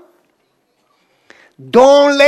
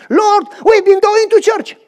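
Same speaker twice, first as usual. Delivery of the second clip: higher, louder anxious protest; pleading plea; 9 semitones higher, 4 dB louder than usual. Acted. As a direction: beg to be let in. Foolish virgins pleading with Lord.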